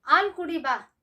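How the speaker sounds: ಹಾಲ್ ಕುಡಿ ಬಾ with ಹಾಲ್ said as "aal", high and loud; ಹಾಲ್ mispronounced as "aal", with no H; wrong pronunciation, dropped H. Shown as a demonstration. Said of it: "It is wrong pronunciation".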